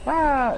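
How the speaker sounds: high playful scream, echoing; a toddler's happy shriek, retold; imitated toddler scream. Dad imitating his daughter's slide scream, high playful shriek. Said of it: "I didn't really go like".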